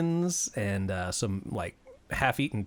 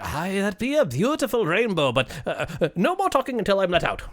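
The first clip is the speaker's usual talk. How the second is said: quick, higher-voiced leprechaun refusal; huffy leprechaun; 6 semitones higher than usual. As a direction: admire briefly, then refuse in a huff. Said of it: "he's like".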